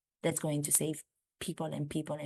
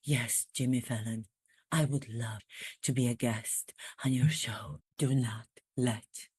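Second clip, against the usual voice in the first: lightly voiced Prince Harry, a touch lower; low mock Harry on the phone to Fallon; 5 semitones lower than usual. Comedian playing Harry telling Jimmy Fallon he'll come. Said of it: "I got into that voice".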